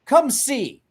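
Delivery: high, loud urgent plea; urgent, pulling him along; upset Mama. Retold or imitated. Mama leading him to the bathroom: high, loud urgent call. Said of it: "she's like".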